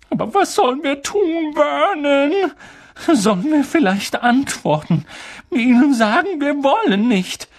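high, fretful questions; anxious pleading. A narrator's reading. Aunt asks her husband what to do, anxiously. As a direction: plead helplessly, pitched high.

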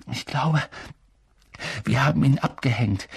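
lightly winded, halting delivery; out of breath. A narrator's reading. Harry to his friends after running, lightly out of breath. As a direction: pause to catch your breath mid-sentence. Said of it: "stieß Harry außer Atem hervor".

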